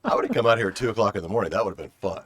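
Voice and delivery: loud, fast and eager; excited. Real, not acted. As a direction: blurt it out with a grin. Joking about two a.m. visit.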